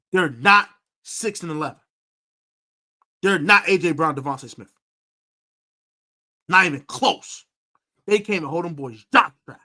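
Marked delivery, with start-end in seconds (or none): 0.1-7.5 louder, punching every "not"; emphatic insistence. Real, not acted. Telling viewers the Falcons receivers don't compare, emphatically. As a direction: hammer the point home to the viewers. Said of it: "understand the emphasis I'm putting on this".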